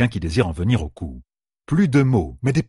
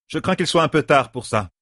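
higher voice, mildly projected; raised to carry; 6 semitones higher than usual. A narrator's reading. A sister answers over the murmurs, voice raised slightly. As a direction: answer so the back rows hear. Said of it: "Assez fort pour être entendu".

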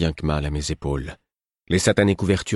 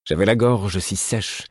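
plain reading voice

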